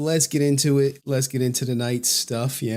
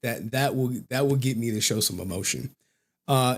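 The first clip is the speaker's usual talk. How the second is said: normal talking voice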